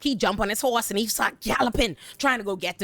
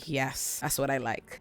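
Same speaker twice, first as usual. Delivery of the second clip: soft satisfied aside; 6 semitones lower, 8 dB quieter, slower than usual. Retold or imitated. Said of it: "And I say".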